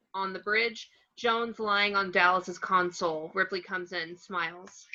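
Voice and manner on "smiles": ordinary delivery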